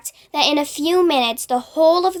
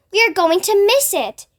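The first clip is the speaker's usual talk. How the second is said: higher, urgent shout; warning everyone in a rush; anxious shout; 6 semitones higher than usual. A narrator's reading. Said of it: "shouted the ladybird".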